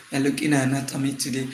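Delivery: quiet, fast mumble under the breath; a shy student muttering the answer to nobody; timid mumble. Shown as a demonstration. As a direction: mumble it like you're scared to say it. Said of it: "you say it out, you say it loud".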